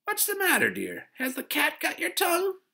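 very high, loud busybody voice; patronizing neighbor. A narrator's reading. Neighbor prods a child who won't answer, patronizing high voice. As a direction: prod a silent child in piercing sing-out tones.

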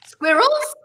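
high squeal of disbelief then a laugh; high, amused squeak at a viewer's squirrel question; amused surprise. Real, not acted. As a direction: squeak the word in delighted surprise.

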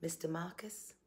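Mister Marcus drawled lightly in a Southern accent, rising at the end. Shown as a demonstration. Bride savors her fiancé's name with a light Southern accent. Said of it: "sounds good with a Southern accent".

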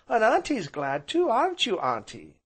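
put-on high, eager child voice; small boy. A narrator's reading. Small boy prompts aunt to agree, high and eager. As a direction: ask high and keen, like a little boy.

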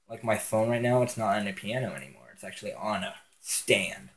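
usual speaking voice

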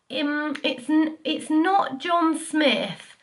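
a hint of a cornered little kid stalling; little brother's slip. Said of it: "And he said".

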